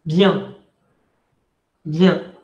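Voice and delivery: "Bien" as one nasal syllable; single syllable. Shown as a demonstration. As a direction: glide "Bien" straight into the nasal vowel. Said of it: "Vean que es una sola sílaba".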